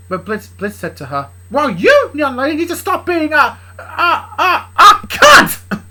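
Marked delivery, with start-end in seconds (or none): 1.5-5.8 high, stammering build to a scream; scold, falter, then scream the insult; angry scolding. Acted. Dad scolds Luna in quarrel.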